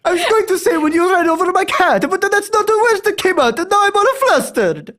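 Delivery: high, loud mock-furious Italian accent; angry Italian. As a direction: rant as a hammed-up, outraged Italian. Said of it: "be as angry as possible as an Italian".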